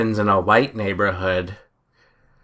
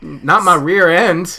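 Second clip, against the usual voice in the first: a touch higher and slower hammed-up protest; mock drunk teen; 6 semitones higher, 4 dB louder than usual. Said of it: "do an impression of it".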